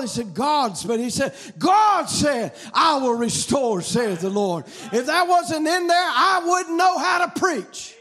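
loud, driving revival sermon delivery; impassioned preacher, rising to shouts; full-force preaching. Real, not acted. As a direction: preach it hard, stretching the big phrases.

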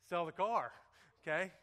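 loud flat order; booming advice-show command; Dave Ramsey. Retold or imitated. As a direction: bark it like a money coach. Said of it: "What does Dave Ramsey say?".

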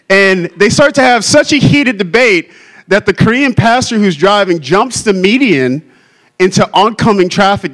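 normal talking voice

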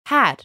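Har said high and clear, like a model; Persian word. Shown as a demonstration. Teacher models Har for the class. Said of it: "Har means any".

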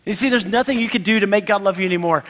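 ordinary talk, nothing marked

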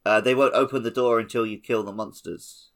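usual speaking voice

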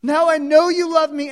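God declaring relief and love to Abraham; loud joyful declaration. Acted. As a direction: proclaim it with emotion. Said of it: "and God says".

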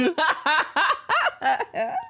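a long, high burst of laughter. Real, not acted. Host cracking up at co-host's word slip. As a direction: crack up at her mistake.

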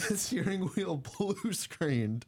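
high, drawn-out laughing disbelief; helpless amusement, stretched out and high. Real, not acted. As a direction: say it slowly through laughter. Laughing at the screen mishap.

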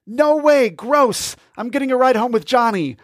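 high grossed-out brother; squeaky disgusted kid. Acted. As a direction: squeal it and bolt.

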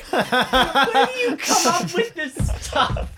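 high and slow through laughter; laughing. Real, not acted. Player cracking up at the chutney tangent. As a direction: laugh out the question in disbelief. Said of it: "laughing at the chutney talk".

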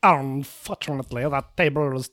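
low, hammy French waiter voice; French accent. Acted. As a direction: put on a thick French accent. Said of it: "I am losing this accent".

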